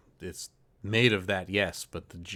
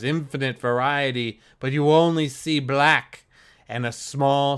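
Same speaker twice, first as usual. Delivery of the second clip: game master narration; 3 semitones higher, 4 dB louder than usual. A narrator's reading. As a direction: set the scene, dropping to a whisper. GM scene narration.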